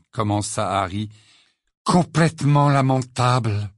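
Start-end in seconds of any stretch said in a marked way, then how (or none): 1.8-3.7 voix caverneuse, lente et sombre; lâche-le d'une voix creuse et caverneuse. A narrator's reading. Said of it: "d'une voix caverneuse". (voix caverneuse d'un Ron abattu)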